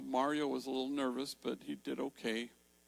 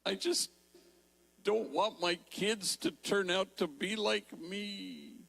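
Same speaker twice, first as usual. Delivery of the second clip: higher and slow, words forced out one by one; shaking through the whole sentence; trembling; 8 semitones higher, 5 dB louder, slower than usual. Retold or imitated. Mario forces out his answer to the woman, slow and trembling. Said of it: "He's trembling".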